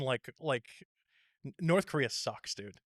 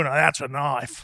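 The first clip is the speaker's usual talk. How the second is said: slow, loud Australian movie-line impression; broad put-on Australian, drawn out and punchy; Australian accent; 5 dB louder than usual. Comic quoting a man pulling out a machete, Australian accent. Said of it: "when I think of Australian".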